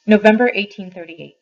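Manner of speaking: loud heading read; reading the date heading aloud; announced date. Acted. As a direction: call out the letter date.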